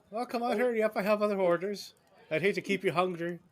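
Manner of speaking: high, loud, bustling hostess; hammed-up busy barmaid yell; shrill rambunctious barmaid. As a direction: hurry the customers along shrilly. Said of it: "a kind of a shrill voice yell".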